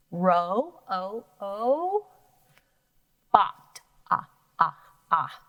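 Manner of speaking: long O then short ah; sounding out vowels. Shown as a demonstration. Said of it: "two different O sounds".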